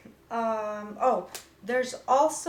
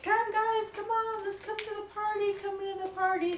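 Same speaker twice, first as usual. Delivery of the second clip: playful coaxing voice; 8 semitones higher than usual. Pretend. Platelets voiced inviting to aggregate.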